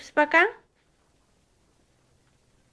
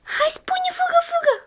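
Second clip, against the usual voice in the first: playful coaxing. Real, not acted. Parent urging a child to say run, very high playful voice.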